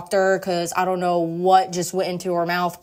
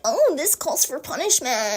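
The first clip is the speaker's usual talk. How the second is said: high, slow, hammed-up relish; mock horny voice; 7 semitones higher than usual. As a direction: draw it out high as a teasing imitation. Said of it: "her horny ass is like".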